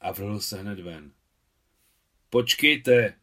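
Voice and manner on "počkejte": a loud commanding call; the officer calling after the fleeing boys, loudly. A narrator's reading. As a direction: stop them with a firm shout. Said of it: "křikl duče".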